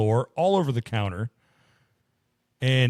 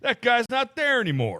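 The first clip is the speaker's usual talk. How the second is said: very high mock-griping old-timer; hammed-up campfire complaint; 12 semitones higher than usual. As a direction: play a wronged storyteller, pitch way up. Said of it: "sitting around a campfire eating baked beans".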